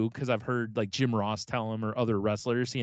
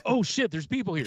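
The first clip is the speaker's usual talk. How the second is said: higher startled mock gasp; caught off guard; 8 semitones higher than usual. Wrestler caught making out at the buffet, startled. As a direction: blurt it like you just got caught.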